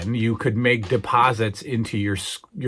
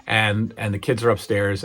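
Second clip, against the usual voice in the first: ordinary talk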